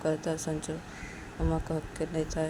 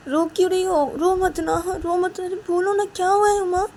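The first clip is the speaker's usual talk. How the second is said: much higher, louder, urgent concern; alarmed Sanju; 13 semitones higher, 10 dB louder than usual. Acted. Sanju begging crying Uma to speak, alarmed. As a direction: plead in a high, insistent rush of questions.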